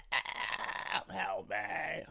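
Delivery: high, strained little plea as if dying; mock cry for help. Picturing his own gruesome death, mock cries for help.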